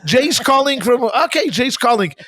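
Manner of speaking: imitating a high shrill announcer; squeaky high-pitched call-out. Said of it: "this high, shrilled voice".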